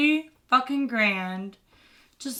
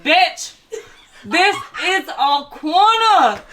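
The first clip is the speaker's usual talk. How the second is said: loud, high, drawn-out turf claim; comic street-corner bellow; hammed-up confrontation; 7 semitones higher, 9 dB louder, much slower than usual. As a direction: stake your claim in a slow mock yell. Acted turf quarrel over the corner.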